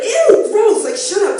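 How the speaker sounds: high, loud disgusted groan; mock disgust. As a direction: recoil in disgust. Mock disgust at loud pillow talk.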